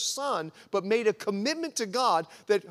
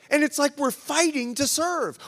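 usual speaking voice

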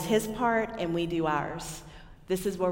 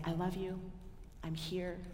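put-on softer, gentle words to a crying child; tender hush; 11 dB quieter than usual. Mother to her upset son, soft and tender. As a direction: soothe him softly, close to his ear.

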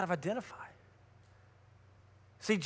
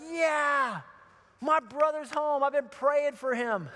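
raised, drawn-out cheer; pretend rejoicing; 4 semitones higher, slower than usual. Imagined older brother hearing of the return, hammed-up joy. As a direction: celebrate as the brother ideally would.

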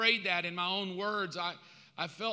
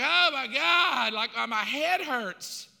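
slow, drawn-out, higher cry of overload; hammed-up overwhelmed lament; exasperated wail; 5 semitones higher, 5 dB louder, slower than usual. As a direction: moan it loudly as if your head is splitting. Acts exasperation at the bickering.

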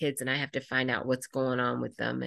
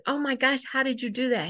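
delighted praise; 9 semitones higher, faster than usual. Retold or imitated. Teacher praising boy's award.

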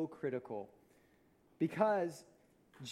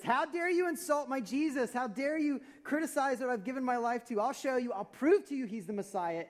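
higher, louder indignant retort, hammed up; ticked off; 9 semitones higher, 6 dB louder than usual. Preacher voicing Philip snapping back at Nathanael, mock-angry and loud. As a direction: play Philip as mock-furious, defending Jesus. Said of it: "Philip, he gets ticked".

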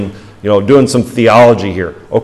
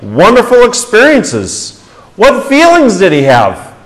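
raised, loud pulpit questions to the congregation; fervent preaching; 6 semitones higher, 4 dB louder than usual. Acted. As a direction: preach it out, voice lifted high and strong. Preaching at full force.

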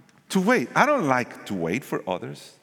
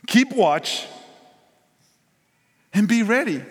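louder and higher, Keep watch and be ready proclaimed; forceful command; 8 semitones higher, 4 dB louder than usual. Acted. Preacher pressing the church to obey, loud proclamation. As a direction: ring it out to the whole church. Preacher repeats the two instructions.

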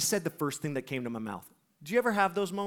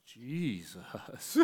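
hushed wonder; 5 semitones lower, 10 dB quieter than usual. Retold or imitated. Skater retold reacting with hushed wonder.

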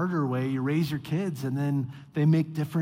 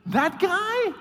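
much higher, incredulous squawk; can't believe what you're hearing; outraged disbelief; 12 semitones higher, 3 dB louder, slower than usual. Retold or imitated. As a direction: Nehemiah scoffs at Tobiah living in the temple, much higher. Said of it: "And Nehemiah's like".

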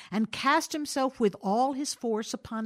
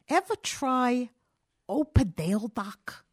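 constrained; 3 semitones higher, 3 dB louder, slower than usual. A narrator's reading. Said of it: "he said constrainedly".

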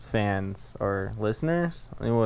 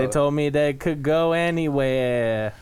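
Forrest Gump; 3 semitones higher than usual. Acted. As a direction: drag out the line like the film character. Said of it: "That's how Forrest talks".

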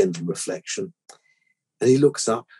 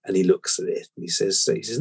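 ordinary delivery

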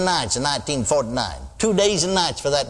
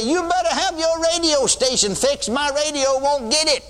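high, pushed voice of an annoyed caller; mock complaining caller; 9 semitones higher than usual. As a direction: play a whiny radio listener, well above own pitch. Mock caller complaining about radio.

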